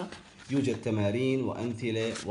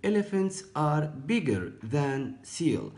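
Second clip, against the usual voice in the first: slow read; 4 semitones higher, much slower than usual. Shown as a demonstration. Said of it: "teacher reads example sentence".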